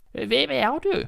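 high, frail granny voice asking who's there; a wary old woman calls through the door; old grandmother. Acted. Grandmother calls to the door, high and frail.

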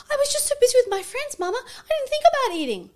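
put-on high, loud, girlish voice; imitating her daughter. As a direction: chirp it like a busy little girl. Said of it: "she goes".

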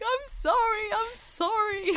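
high, wailing mock sob; mock crying Jack. Imitating Jack sobbing in the car. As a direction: whimper it like a blubbering man. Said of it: "and he's just crying".

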